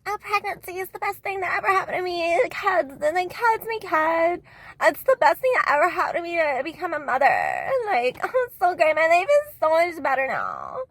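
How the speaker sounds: high-pitched mock mom voice; squeaky put-on YouTube mom; gushing mom. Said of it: "and she's like".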